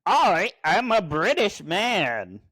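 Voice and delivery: high, stretched-out fake British accent; mock transatlantic accent. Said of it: "Transatlantic accent intensifies".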